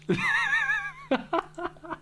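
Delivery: high, long helpless laugh; cracking up in disbelief; burst of laughter. Real, not acted.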